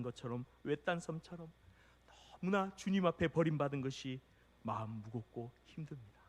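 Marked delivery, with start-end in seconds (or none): none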